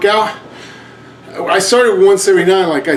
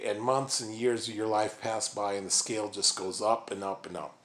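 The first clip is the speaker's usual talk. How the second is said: much softer, lower, hushed talk; quiet; 4 semitones lower, 10 dB quieter than usual. Real, not acted. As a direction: murmur low and tired. Sudden much softer lower delivery.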